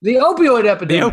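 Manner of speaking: mock cheerful suggestion. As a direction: toss out the grim topic as a joke. Deadpan dark joke topic suggestion.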